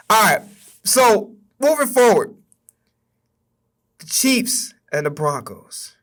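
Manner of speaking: usual speaking voice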